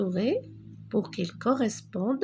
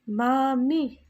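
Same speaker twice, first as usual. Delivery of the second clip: "Mamie" said louder and higher, both syllables clear; emphatic model word; 4 semitones higher, 4 dB louder than usual. Shown as a demonstration. Teacher to children, models word loudly, louder and higher. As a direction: hold up "Mamie" brightly for the class. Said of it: "Répétez après moi".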